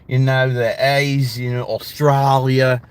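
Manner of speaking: slow, drawn-out Aussie vowels; exaggerated Australian English; Australian accent. Shown as a demonstration. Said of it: "My Australian accent is actually a little better".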